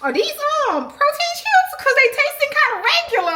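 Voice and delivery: high, puzzled voice asking about the chips; a high, mock-confused "protein chips?". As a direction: act baffled the chips taste plain. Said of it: "I was like".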